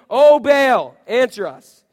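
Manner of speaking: loud, high plea to a god; shouting. Acted. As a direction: call out to Baal, loud and dramatic.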